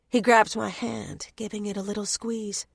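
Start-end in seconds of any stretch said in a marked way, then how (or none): none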